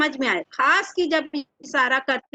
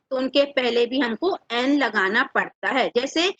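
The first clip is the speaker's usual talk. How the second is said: ordinary talk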